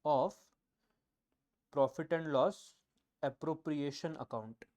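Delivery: very slow, one word at a time; slow dictation. Shown as a demonstration. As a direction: spell it out for their notebooks. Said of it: "Second, if there is net loss". (धीरे-धीरे लिखवाना)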